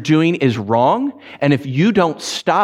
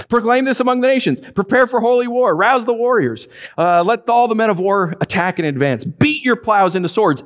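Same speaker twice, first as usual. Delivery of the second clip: higher, quicker mock-grand war call; sarcastic proclamation; 7 semitones higher than usual. God taunting the nations, mock-grand and high. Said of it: "here God uses sarcasm".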